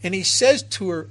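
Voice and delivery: usual speaking voice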